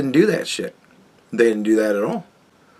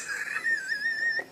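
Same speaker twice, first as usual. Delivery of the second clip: squeaky laugh; 15 dB quieter than usual. Real, not acted. Laughs mid-rant.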